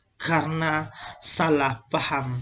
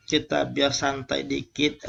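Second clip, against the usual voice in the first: usual speaking voice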